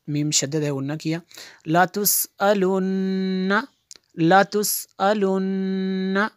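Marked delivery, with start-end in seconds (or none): 1.7-6.3 लातुसअलुन्ना with the doubled n nasalized, rising; ghunna on nn. Shown as a demonstration. Teacher to students, showing ghunna on the doubled n. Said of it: "नून में शद्दद है, गुन्ना किया".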